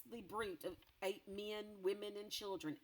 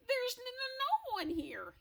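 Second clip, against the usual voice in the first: much higher, stuttering and scared; nervous stammer; 6 dB louder than usual. Acted. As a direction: stammer out the lie. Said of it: "he stammered".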